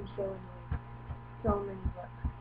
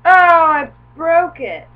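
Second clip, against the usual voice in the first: slow, loud, shocked cry; loud dismay; 7 semitones higher, 20 dB louder, slower than usual. Real, not acted. Vlogger finds her Transformer broken, loud slow shock.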